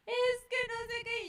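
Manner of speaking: put-on high-pitched whiny wail of a child; crying child. Child coming to mother in tears, mock crying. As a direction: whine it high, as a tearful kid. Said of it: "llega el niño llorando".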